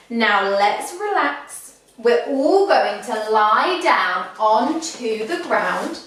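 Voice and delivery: ordinary talk, nothing marked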